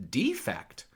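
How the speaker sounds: "defect" with DE drawn out and punched; stress on first syllable. Shown as a demonstration. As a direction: hit the first syllable of "defect" hard, slowly. Puns effect against defect.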